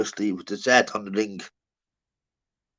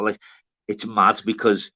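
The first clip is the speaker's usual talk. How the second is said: ordinary talk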